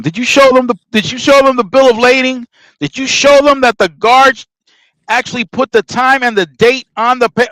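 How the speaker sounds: indignant grilling of his partner; urgent questioning. Retold or imitated. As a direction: fire the questions at him. Grilling partner about paperwork.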